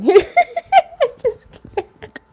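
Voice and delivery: high, breaking laughter; real laughter that can't be held; laughing. Real, not acted. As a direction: giggle out the words. Giggle fit during reading.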